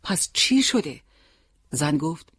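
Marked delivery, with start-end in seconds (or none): 0.0-1.0 higher, mildly anxious question; worried. A narrator's reading. Husband pressing his silent wife, worried. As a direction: press gently for an answer. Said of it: "با نگرانی پرسید".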